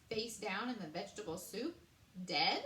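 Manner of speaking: ordinary delivery, nothing marked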